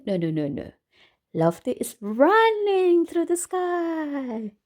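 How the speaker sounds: usual speaking voice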